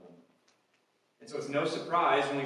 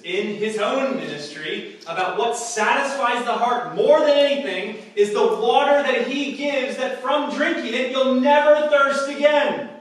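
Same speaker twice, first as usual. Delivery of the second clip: building higher and louder to the last phrase; preaching at full force; 10 semitones higher, 13 dB louder than usual. Acted. Preacher promising the congregation they will never thirst, building up. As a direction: climb to the promise and land it.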